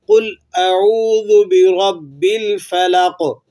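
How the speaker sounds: Quran recitation. Shown as a demonstration. Teacher recites the whole verse for students. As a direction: recite the verse in full tajweed. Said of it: "دوبارہ سے دہرا لیجئے".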